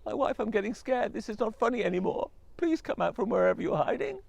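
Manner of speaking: high, loud frightened calling; scared husband calling. Acted. Husband calling his vanished wife, frightened. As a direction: call out for her, getting scared.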